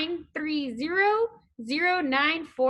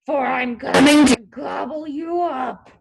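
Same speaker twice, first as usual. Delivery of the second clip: loud troll threat; troll's roar; 7 dB louder than usual. A narrator's reading. Troll threatens to eat the little goat, roaring. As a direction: threaten loudly as the hungry troll. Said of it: "roared the troll".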